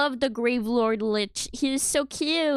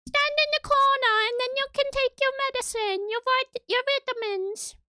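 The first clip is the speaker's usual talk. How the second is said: high put-on voice scolding naughty children; higher playful nanny telling them off; hammed-up teacher scolding chat; mock scolding; 12 semitones higher than usual.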